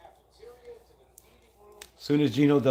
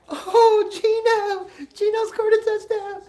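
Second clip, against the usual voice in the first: high mocking voice; 4 dB louder, slower than usual. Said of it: "Daddy Pete's like".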